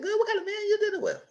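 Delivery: very high, laughing mock question; amazed and amused; laughing disbelief. To Kelly about the man, high laughing disbelief. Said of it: "I said".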